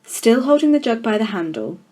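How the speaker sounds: usual speaking voice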